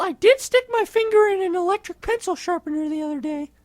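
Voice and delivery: ordinary talk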